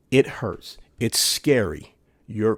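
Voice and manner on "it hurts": ordinary talk, nothing marked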